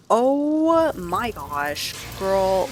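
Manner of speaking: long, loud, high "oh"; real shock at a sudden mishap; shocked exclamation. Real, not acted. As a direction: draw it out in loud, high-pitched alarm. Reacts to sudden kitchen fire.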